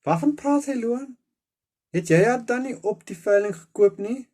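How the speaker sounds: put-on high, loud shocked girl. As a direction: gasp it out in disbelief. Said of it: "Lana draai geskok na my".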